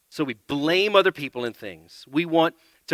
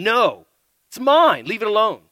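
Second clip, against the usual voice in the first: loud, higher, snapping it's mine; harsh childish claim; 7 semitones higher, 9 dB louder than usual. Acted. A child snatching a toy from a sibling, harsh and loud. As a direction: bark it like a grabby child. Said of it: "the harsh word stirs up".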